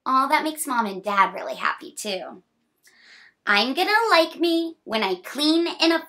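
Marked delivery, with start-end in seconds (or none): 3.5-6.0 bright, sing-song picture-book reading; reading to children. A narrator's reading. Reading a picture book to a child, sing-song. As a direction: read the rhyme cheerfully to a small child.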